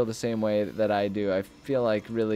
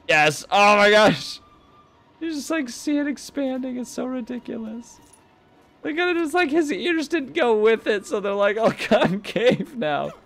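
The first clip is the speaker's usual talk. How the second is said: much higher, giddy exclaiming; delighted glee; 11 semitones higher than usual. Real, not acted. Gamer watching a head expand, giddy and high. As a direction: squeal with delight.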